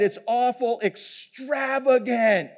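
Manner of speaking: slow, lightly put-on learned doctor; retold doctor's big words. Doctor marvelling at glands on a leaf, mock-pompous. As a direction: draw out EXTRAVAGANT in mock grandeur. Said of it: "you know this ain't my words".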